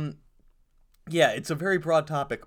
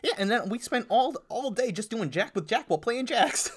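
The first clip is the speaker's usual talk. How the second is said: grandma voice; 9 semitones higher than usual. Pretend. Continues grandma's story.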